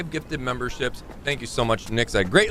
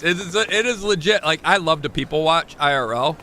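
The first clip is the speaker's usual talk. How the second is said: ordinary delivery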